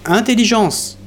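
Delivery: calling out. Acted.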